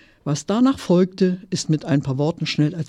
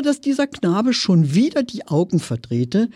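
plain reading voice

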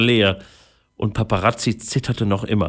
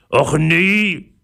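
raised-pitch groan of dismay; groaning pirate; 7 semitones higher, 4 dB louder than usual. A narrator's reading. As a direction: moan it as a fed-up pirate. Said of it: "stöhnte Säbelzahn". (gestöhnter Piratenprotest)

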